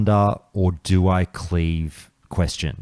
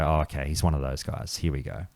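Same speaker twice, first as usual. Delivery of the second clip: resigned muttering; 4 semitones lower, 10 dB quieter than usual. Retold or imitated. Retold inner eye-roll at rude player.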